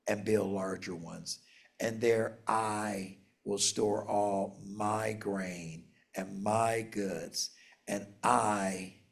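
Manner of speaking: each I leaned on, read slow and a little low; stressing I. Shown as a demonstration. Pastor reading the rich man's plans, stressing every I. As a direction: slowly press every I of the boastful rich man. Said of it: "notice how many times I'm emphasizing that".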